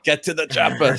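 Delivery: put-on lightly done Austrian accent on "Get to the chopper"; Arnold Schwarzenegger impression. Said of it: "my dad sounded like Arnold Schwarzenegger".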